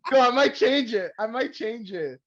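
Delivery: laughing through the words, high and slow; can't stop laughing, voice breaking; laughing hard. Real, not acted. Cracking up over their new podcast outro, laughing hard. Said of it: "laughing at the outro".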